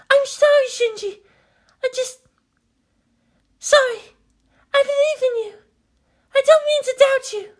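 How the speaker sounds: remorseful Maya. Acted. Maya apologizing to Shinji, high and contrite. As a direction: hush the sorry, then reassure.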